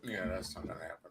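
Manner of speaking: muffled and far from the mic. Real, not acted. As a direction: talk through a cloth. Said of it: "You sound kinda muffled".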